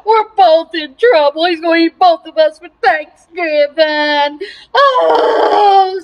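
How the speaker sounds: loud, high-pitched comic bawling; crying. Acted. Doomed pig and turkey wailing to each other, hammed-up crying. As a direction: wail it out loud, drawn out and hammed-up. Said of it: "they started crying".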